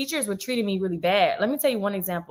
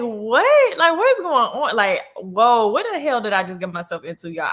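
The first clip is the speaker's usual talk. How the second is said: shocked disbelief; 2 semitones higher, 4 dB louder than usual. Retold or imitated. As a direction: react like you can't believe it. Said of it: "I'm like".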